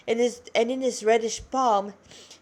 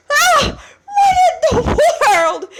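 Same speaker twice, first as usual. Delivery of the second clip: sudden high shout breaking into a laugh; shocked demon hybrid crying out in alarm; startled exclamation; 12 dB louder, slower than usual. Acted. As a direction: yelp it out, high and loud, then laugh. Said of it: "exclaimed the demoniac hybrid".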